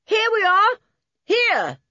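loud, high-pitched holler; calling out. A narrator's reading. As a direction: shout up so they can locate you. Trapped boy calls to rescuers.

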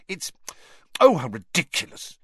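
fed-up outburst, rising voice; exasperated. A narrator's reading. Hermione exasperated.

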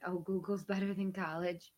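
ordinary talk

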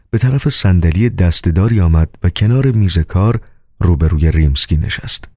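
usual speaking voice

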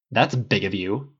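slow, sarcastic and sharp. A narrator's reading. Tony answering Harris's backhanded praise, sharply. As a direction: cut him off with dry sharpness. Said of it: "Tony said sharply".

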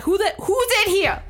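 high mock call into the tent; squeaky voice asking who's inside. Counselor shaking the tent, high mock call.

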